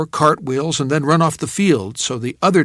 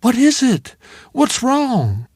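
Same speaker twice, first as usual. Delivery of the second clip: worried; 10 semitones higher, 4 dB louder, slower than usual. Acted. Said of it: "Now she sounded worried".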